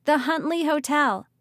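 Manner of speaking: high, loud shouted destination. Acted. Ridley shouting the destination to the group. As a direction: call the name out over the wind. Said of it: "she shouted".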